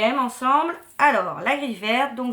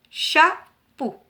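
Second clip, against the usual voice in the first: "Chapeau" in two higher, louder separate beats; syllable by syllable; 6 semitones higher, 7 dB louder than usual. Shown as a demonstration. Teacher combines two picture words, syllable by syllable. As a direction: join cha and peau as two distinct beats in "Chapeau". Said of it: "composé de deux syllabes".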